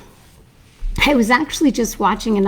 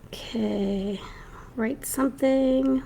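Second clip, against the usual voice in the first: ordinary delivery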